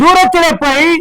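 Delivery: high, loud preacher's repeat; emphatic scripture echo. Real, not acted. Preacher echoing the reader's verse to the congregation, high and loud. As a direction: ring the verse out over the reader.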